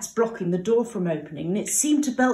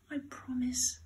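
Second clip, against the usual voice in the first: soft, sleepy whisper; whispered promise; 4 semitones higher, 9 dB quieter than usual. Acted. Nearly asleep pig whispering to his mother. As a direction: breathe it out half asleep.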